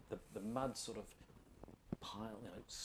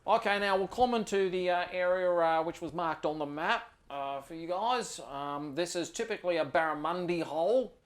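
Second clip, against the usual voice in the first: higher, louder easygoing Aussie ranger giving a tour; 4 semitones higher, 4 dB louder than usual. Acted. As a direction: play the laid-back outback ranger showing the spot. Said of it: "Dan Slade says".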